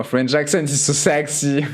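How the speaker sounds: English in a thick French accent. Shown as a demonstration. Streamer defending the accent in English, French accent. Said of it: "vous aimez pas mon accent".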